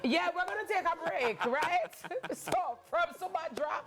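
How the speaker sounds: high-pitched, laughing sign-off; trying to announce the break while cracking up; fighting laughter. Real, not acted. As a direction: push on through the giggles. Host signs off through laughter.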